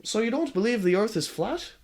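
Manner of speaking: put-on Marty's voice drifting into a different accent; Marty's accent sliding off; "So you don't believe" with the accent wandering; slipping accent. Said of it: "His accent is changing".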